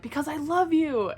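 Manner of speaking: loud, high shouted declaration; hammed-up shout of love; mock shouted confession. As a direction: blurt it out like the climax of a fight. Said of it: "like, arguing and shouting".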